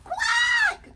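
very high, loud quack; excited duck shout. Acted. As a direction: shriek a quack of welcome. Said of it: "Everyone's so excited to see him".

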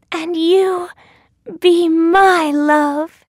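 high, slow heroine voice; Thumbelina. A narrator's reading. Returning the prince's proposal, high slow heroine voice. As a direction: answer high and draw it out.

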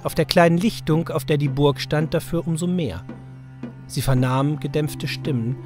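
ordinary delivery

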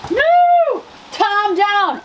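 high, loud playful wail of alarm; mock-dramatic cry. Florist drops a tulip, mock-dramatic shout. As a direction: cry out as if a comrade fell.

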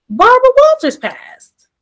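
high and loud, blurting out sudden news; shocked announcement. Retold or imitated. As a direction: break the news like you just heard it. Shocked news after a call.

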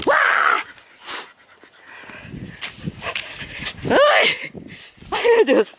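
high squealing mock alarm; playful panic. Real, not acted. Owner squealing as her dog rushes her, in high playful panic. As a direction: squeal for help as the dog jumps at you.

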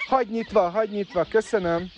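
calling out. Real, not acted. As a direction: call over to keep the gate open. Calling to someone at gate. (felkiáltás a látogatónak)

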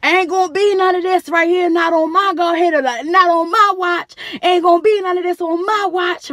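high, loud, firm ruling; stern staff member. Retold or imitated. Staff member stopping a fight, loud stern ruling. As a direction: lay down the law. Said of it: "the staff member come".

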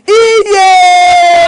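long, high, loud whoop; exultant sustained yell; jubilant shout. Acted. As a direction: let out a drawn-out cry of triumph. Whooping in celebration.

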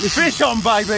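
yelling out the strike; excited shout. Real, not acted. Angler to his mate as the fish bites, excited shout.

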